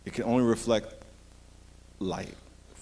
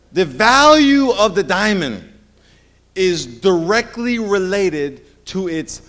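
very slow, leaning on each word; a preacher drawing out his point; slow emphasis; 4 semitones higher, 6 dB louder, much slower than usual. Shown as a demonstration. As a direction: spell it out for the congregation. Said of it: "slow emphatic teaching".

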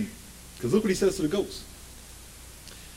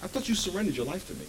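quiet; 13 dB quieter than usual. Pretend. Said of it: "And God said".

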